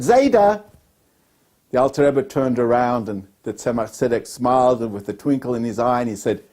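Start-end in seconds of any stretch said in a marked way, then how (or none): 0.0-0.6 calling Zayda out, a bit louder; a child calls his grandfather back, raised voice. Retold or imitated. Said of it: "in a loud voice said".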